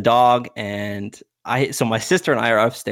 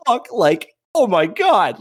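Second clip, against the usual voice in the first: high, louder burst of outraged disbelief; retold disbelief; 10 semitones higher, 4 dB louder, slower than usual. Teller hears the trainer is hungover, aghast. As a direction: exclaim it, aghast and high-pitched.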